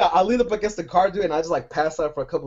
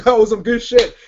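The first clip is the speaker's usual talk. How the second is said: loud delighted relish; 5 semitones higher, 8 dB louder, slower than usual. Retold or imitated. Relishing the hot dog bite.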